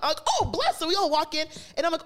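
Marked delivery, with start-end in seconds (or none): 0.4-0.8 high, relieved little exclamation; delighted relief. Retold or imitated. Friend hears they will be brought in, delighted relief. Retelling relief at being let in.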